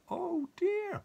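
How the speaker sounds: a high, hammed-up oh dear; mock dismay. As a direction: gasp it out in pretend dismay for the child. Storyteller's aside to the child.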